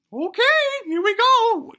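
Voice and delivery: high, loud falsetto granny; gleeful granny. Acted. Granny about to whisk the wife away, gleeful. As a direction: sing out the warning as granny.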